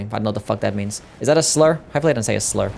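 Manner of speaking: ordinary delivery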